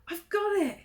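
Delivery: high, loud eureka squeak; excited mouse. Acted. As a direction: burst out with a bright idea. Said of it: "he said".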